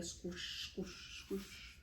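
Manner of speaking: breathy whispered squishing; whispered squelch noises. A narrator's reading. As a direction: hiss out each squish. Marmalade blob sound effect.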